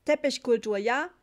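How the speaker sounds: loud, high, brusque retort; unfriendly. A narrator's reading. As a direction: fling it back rudely with a questioning tag. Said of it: "sagte der Reiseführer unfreundlich". (unfreundlich und laut)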